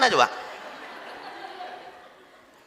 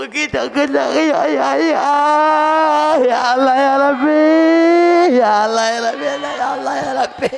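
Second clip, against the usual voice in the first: hammed-up crying wife, higher and louder; mock wailing; 7 semitones higher, 7 dB louder than usual. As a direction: wail to God in an overdone sob. (meratap pura-pura menangis)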